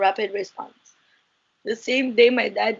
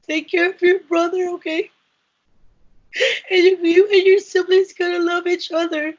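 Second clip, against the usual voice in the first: higher, crying through the mother's last words; tearful quote; 7 semitones higher, 3 dB louder than usual. Retold or imitated. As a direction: voice cracking as she passes on mom's plea.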